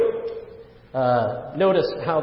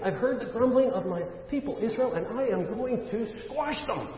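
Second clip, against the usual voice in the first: high, softer pretend divine threat; mock threat; 8 semitones higher, 4 dB quieter than usual. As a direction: play at a punishing verdict, pitched high but held back in volume. Imagines God's answer as a sinner.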